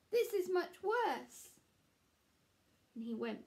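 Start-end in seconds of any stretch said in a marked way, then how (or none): 0.1-1.5 much higher, louder, slow despair; upset Square. Acted. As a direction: drag it out, things worse. Square's carving gets worse.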